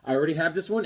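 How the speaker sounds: ordinary talk, nothing marked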